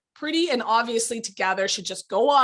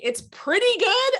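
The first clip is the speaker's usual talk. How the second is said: mock chirpy; 11 semitones higher, 4 dB louder than usual. Shown as a demonstration. Mocking the word pretty.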